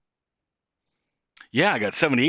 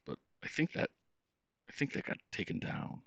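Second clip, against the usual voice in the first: whispered aside; 6 semitones higher, 10 dB quieter than usual. Real, not acted. Aside about a deleted episode.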